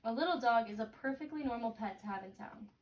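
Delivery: ordinary talk, nothing marked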